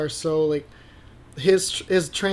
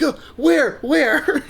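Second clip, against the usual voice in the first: startled laughing; 10 semitones higher, 4 dB louder, much slower than usual. Retold or imitated. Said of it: "I'm like".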